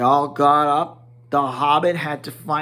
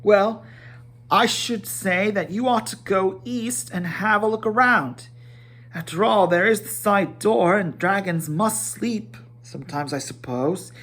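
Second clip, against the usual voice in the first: higher, fussy hobbit host; Bilbo voice; 5 semitones higher than usual. Acted. Bilbo sending the dwarves to bed, higher fussy hobbit voice. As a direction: lift into the fussy little hobbit.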